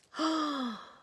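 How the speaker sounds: sharp high gasp of mock horror; a quick scandalised intake of breath; shocked gasp. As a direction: gasp as if you can't believe it. Reacting to the crash story.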